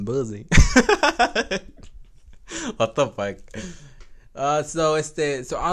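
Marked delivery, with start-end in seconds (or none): 0.0-0.5 "buzzy" instead of pussy, b and z. Real, not acted. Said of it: "It's funny how I say it". 0.5-2.0 loud high laugh. Real, not acted. Laughs at his own pronunciation.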